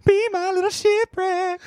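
high, slow crooning monster; lovesick shark voice. Pretend. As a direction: croon it like a smitten creature. Joke voicing a lovesick shark.

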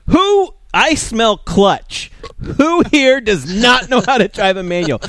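loud, high mock accusation, drawn out; calling them out. Calling out journalists who can't drive manual, mock shout. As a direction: holler it like a hammed-up public challenge. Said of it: "you need to call those people out".

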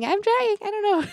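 struggling teacher pleading; flustered plea. Retold or imitated. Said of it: "they think it's so funny".

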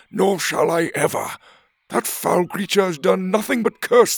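loud Wrong then high proud lizard voice; indignant old gizzard. A narrator's reading. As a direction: defend his honor to the children. Gizzard rant.

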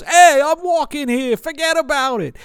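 high, loud hammed-up New York shout; mock New York tough guy; New York accent. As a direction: play the cliché New York hat guy. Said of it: "is if he goes".

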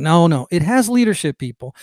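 normal talking voice

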